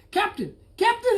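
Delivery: high, urgent call at a door. A narrator's reading. Bullfrog at the cabin door calling out high. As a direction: call up to the captain, eager. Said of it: "he called, knocking on the cabin door".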